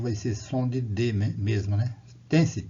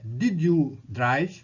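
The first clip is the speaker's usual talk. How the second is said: slow, careful question with a rise; model question; 4 dB louder, much slower than usual. Shown as a demonstration. Teacher showing students how to ask the question. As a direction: spell the question out slowly. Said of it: "Pra fazer a pergunta é".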